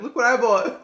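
high, loud, laughing; excited showing off. Retold or imitated. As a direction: show it off with glee. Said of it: "I was like".

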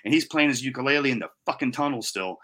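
normal talking voice

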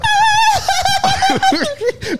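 very high, shouted laughter; real shrieking laugh. Real, not acted. Laughing at the singer.

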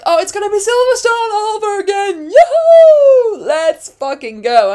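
high, shouted whoop of excitement; ecstatic cheering. Retold or imitated. Fan reliving Lando's P two, ecstatic shouting. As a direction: cheer it out loud and high-pitched.

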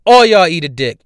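ordinary talk

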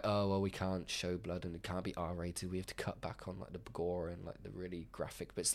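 lightly voiced studio exec; mock studio excuse. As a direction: mimic a prim producer quietly. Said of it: "them being like".

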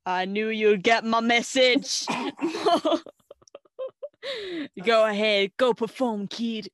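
loud, booming approval; hearty mock Brown. Brown letting Mitchie perform, loud and hearty. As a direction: boom it like a proud mentor. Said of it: "He's like".

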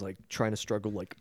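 ordinary talk, nothing marked

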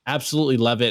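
ordinary delivery

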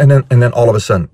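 ordinary talk, nothing marked

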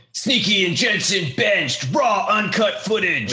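high, slow mock clickbait yell; all-caps headline shout. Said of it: "in all caps".

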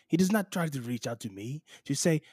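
ordinary talk

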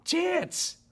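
high, squawked mock dismissal; exaggerated naysayer jeer; sceptical scoff. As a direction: scoff it high, breaking between the words. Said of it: "natural mindset that says".

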